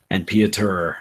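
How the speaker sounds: ordinary talk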